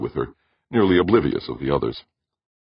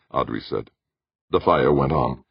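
plain reading voice

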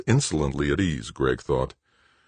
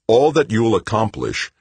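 irritated, louder and higher; annoyed retort; 8 semitones higher, 6 dB louder than usual. A narrator's reading. Director countering his brother, annoyed and louder. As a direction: brush him off with irritation. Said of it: "Greg countered annoyedly".

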